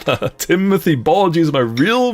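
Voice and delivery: ordinary delivery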